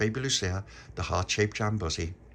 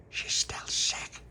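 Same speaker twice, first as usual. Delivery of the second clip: slow, conspiratorial whisper; whispered aside; much slower than usual. Acted. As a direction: whisper it to your friend. Child's aside about sick friend.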